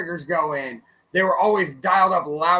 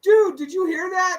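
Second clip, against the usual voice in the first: mock upset drummer; 10 semitones higher, 3 dB louder than usual. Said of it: "he was like".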